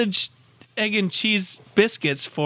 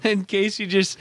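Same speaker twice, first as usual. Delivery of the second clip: ordinary delivery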